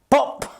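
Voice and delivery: a sudden high little pop, breathed; whispered high pop. A narrator's reading. Narrator making the burrow burst out sound.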